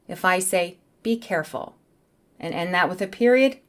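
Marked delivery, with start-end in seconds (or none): 1.0-1.7 calm voice. Shown as a demonstration. Teacher showing how a period sounds, calm. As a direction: say it evenly, ending on a period. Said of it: "I say it in a calm voice".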